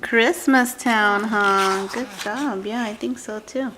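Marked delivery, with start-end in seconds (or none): none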